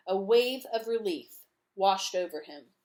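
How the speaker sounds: usual speaking voice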